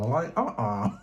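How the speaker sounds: ordinary delivery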